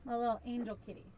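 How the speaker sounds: cooing softly. Real, not acted. Cooing over a favourite cat.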